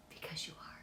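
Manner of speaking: whispered reassurance; soft reassuring whisper. Real, not acted. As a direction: whisper it close and sincere. Reassuring the audience.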